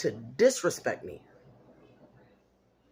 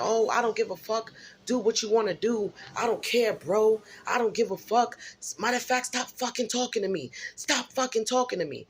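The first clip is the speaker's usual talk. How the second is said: higher, mock teen shouting back; imitating the daughter yelling; 6 semitones higher than usual. A mother imitates her daughter's outburst, higher mock shouting. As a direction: play the daughter snapping at her mom, pitched up. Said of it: "she starts going off".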